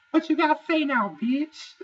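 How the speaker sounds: imitating Lauren's high, loud taunt; mock confrontational yell. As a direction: bark it at her like a challenge. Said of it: "Lauren comes up and goes".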